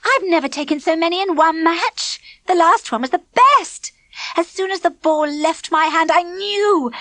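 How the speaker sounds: high, bragging glee; girl gloating about her best wicket; gleeful. A narrator's reading. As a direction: relive the moment with delight. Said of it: "Clipper said gleefully".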